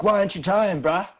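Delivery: loud dudebro taunt; bro voice. Acted. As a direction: sneer it like a gym bro. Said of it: "he's like".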